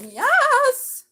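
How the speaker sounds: high, loud shout of yes; sudden thrilled yell at the cards; excited yes. Real, not acted. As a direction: burst out with a joyful yes. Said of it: "Sorry, I got excited".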